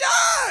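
very high, loud crowd scream; imitated crowd scream. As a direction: shriek like a thrilled audience. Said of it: "And everyone's like".

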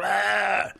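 drawn-out imitated roar; mock roar. Big man held around the waist flails, mock roar. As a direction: imitate a man thrashing in a hold.

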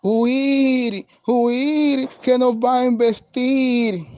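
high, drawn-out cry to flee; alarmed goats. A narrator's reading. Little goats fleeing a big ram, crying out in alarm.

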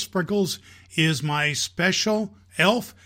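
plain reading voice

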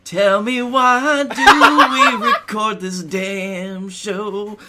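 ordinary talk

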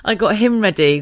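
usual speaking voice

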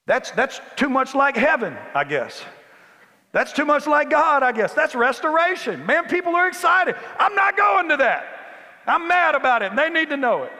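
put-on angry older brother. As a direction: preach the brother's fury, high and loud. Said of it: "The older brother became wroth".

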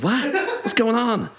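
high, bewildered cry of confusion with a laugh; confused Mark Wahlberg. Retold or imitated. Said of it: "a confused Bostonite".

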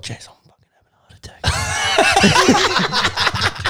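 whispered through laughter, high and slow; panicked laughing whisper. Retold or imitated. Retelling a panic attack at a wedding, laughing whisper. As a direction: gasp it out to Jess, half laughing. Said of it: "I'm like".

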